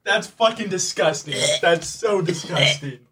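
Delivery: loud, disgusted groan. Real, not acted. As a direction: spit it out in disgust. Reacting to gross jelly bean.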